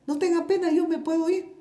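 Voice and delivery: lightly acted, high-voiced reassurance; voicing healed woman. Voices healed woman refusing help.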